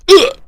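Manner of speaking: short mock grunt of effort; quick throwaway heave sound. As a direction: grunt as if flinging something. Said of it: "she just kinda goes".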